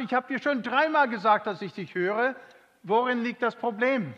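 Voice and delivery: hoch und laut, leicht gereizt nachgespielt; genervte Ehefrau. Retold or imitated. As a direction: als genervte Frau, die sich wiederholen muss. Ehefrau ärgert sich über Schwerhörigkeit. (gereizte Ehefrau, hoch und laut)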